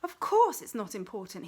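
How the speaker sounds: loud, biting snarl; angry snarl. A narrator's reading. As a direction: snap it back at them, angry. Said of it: "he snarled angrily".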